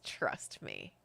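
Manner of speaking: very low, soft and sly; evil grin. Acted. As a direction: purr it with a wicked grin. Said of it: "an evil kind of grin".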